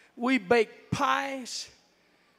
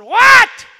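gruff shout; 12 semitones higher, 23 dB louder than usual. Retold or imitated. Grumpy man answering door early.

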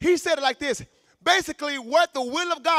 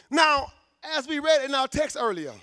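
normal talking voice

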